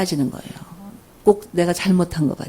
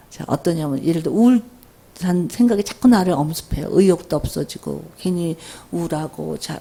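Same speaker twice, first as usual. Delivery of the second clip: usual speaking voice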